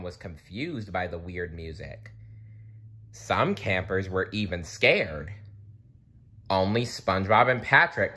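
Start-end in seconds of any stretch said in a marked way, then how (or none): none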